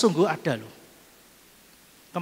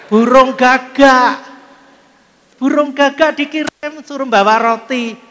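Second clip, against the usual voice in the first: dramatic reveal; 7 semitones higher, 7 dB louder than usual. Acted.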